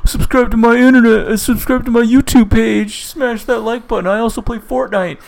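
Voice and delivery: put-on high-pitched kid YouTuber voice; little boy prankster. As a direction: imitate a cheeky child plugging a channel. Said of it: "a little boy who's a prankster".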